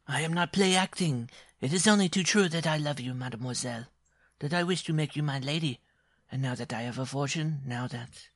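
high, earnest protest of love; indignant dwarf suitor. Acted. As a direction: start high and insistent, then break off.

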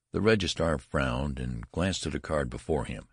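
plain reading voice

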